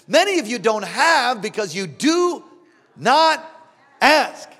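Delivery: punchy pulpit ending; stress on NOT and ASK. Acted. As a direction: land not, then ask, with pauses. Preacher punctuates final words.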